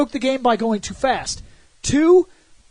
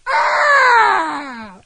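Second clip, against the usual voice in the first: high, loud cartoon shriek; mock character scream; 11 semitones higher, 4 dB louder than usual. The chair screaming when touched, high loud shriek. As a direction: let out a startled cartoon scream. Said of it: "the chair makes a noise".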